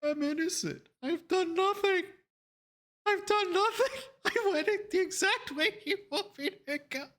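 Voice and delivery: very high comic squeal; mock protest. Driver protesting innocence to passenger, high comic mock protest. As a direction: plead your case in a squeaky exaggerated voice.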